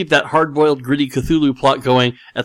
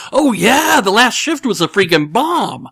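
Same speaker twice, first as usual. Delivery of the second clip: excited; 9 semitones higher, slower than usual. Retold or imitated. Excited friend quoted by text.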